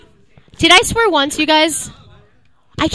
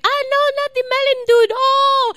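put-on high, wailing Cuban-accented lament; heavy Cuban accent; 12 semitones higher than usual. Daughter imitates her upset mom, heavy Cuban accent. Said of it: "a really heavy, uh, Cuban accent".

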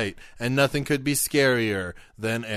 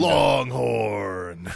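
announcer shout. Acted. Shouting truck name in ad.

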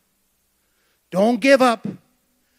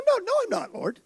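much higher, pleading protest as Peter; 11 semitones higher, 6 dB quieter, faster than usual. Acted. Peter insisting to the Lord he won't deny him, much higher and pleading. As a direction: squeak out a panicked denial.

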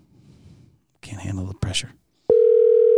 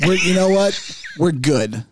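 mock dismissal; 4 semitones higher, slower than usual. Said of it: "And like".

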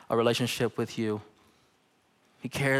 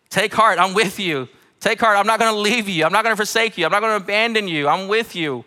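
rising, louder preacher's build on each promise; preaching at full force; 6 semitones higher, 4 dB louder than usual. Acted. Pastor assuring the church, in a building preach.

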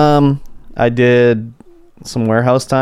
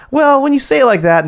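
a hint of a higher sheepish coworker; lightly mimicking coworker; 11 semitones higher than usual. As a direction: lightly voice her backing down. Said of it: "She's like".

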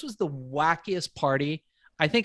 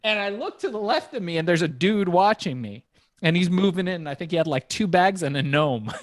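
ordinary delivery

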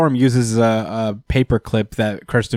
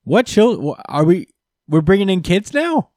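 high, stammering disbelief; mock shock; 8 semitones higher than usual. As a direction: sputter like stunned villains. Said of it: "all of the Thunderbolts are like".

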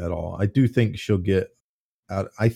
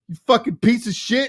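mock furious insult; 13 semitones higher than usual. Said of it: "you're just like".

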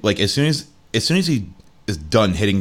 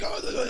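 hoarse groan; 14 semitones higher, 13 dB quieter than usual. Acted. Driver coughing, smoking.

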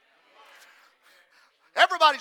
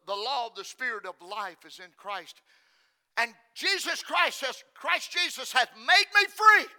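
usual speaking voice

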